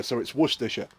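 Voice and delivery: Worcestershire said Wuss-ter-sher; soft quick Worcestershire; correct British. Shown as a demonstration. Teaching a friend the British way. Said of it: "this bit like sure".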